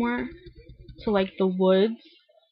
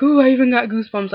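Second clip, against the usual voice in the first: quick, louder thrilled gush; excited gush; 4 semitones higher, 7 dB louder, faster than usual. Retold or imitated. Sister gushing about the episode, quick and thrilled. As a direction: rush it out, giddy and loud. Sister reacting to Tyler's return.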